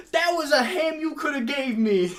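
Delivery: loud, high comic complaint; mock outrage. Friend confronts waiter over binned ham, mock outrage. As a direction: imitate an aggrieved customer yelling.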